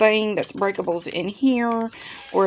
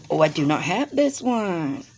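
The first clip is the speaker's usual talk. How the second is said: ordinary delivery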